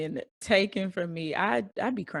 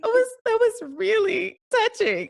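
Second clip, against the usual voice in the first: high, louder, mock-moved voice; mock teary gush; 5 dB louder than usual. Friend imitating herself moved by a Hallmark ad. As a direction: ham up being touched by an ad. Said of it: "and just be like".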